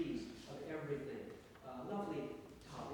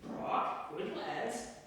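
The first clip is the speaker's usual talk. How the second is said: parrot squawk; 5 semitones higher, 4 dB louder, much slower than usual. Acted. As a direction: parrot blurting a bit loudly. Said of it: "a tad louder than intended".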